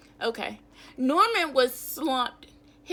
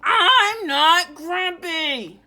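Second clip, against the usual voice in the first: slow, loud, high grumpy yell; angry shout; 6 semitones higher, 10 dB louder, much slower than usual. Acted. Read-aloud story, grumpy Jim shouting back at the animals. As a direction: bellow it like a cross chimp. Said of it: "shouted Gr".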